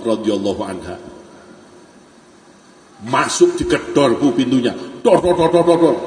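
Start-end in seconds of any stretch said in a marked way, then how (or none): 5.0-6.0 loud "dor dor dor" knocking; door banging. Retold or imitated. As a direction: bang out the knocks with your voice.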